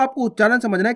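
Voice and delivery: normal talking voice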